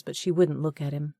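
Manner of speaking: plain reading voice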